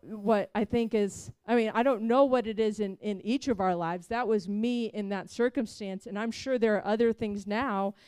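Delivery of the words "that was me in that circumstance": ordinary talk, nothing marked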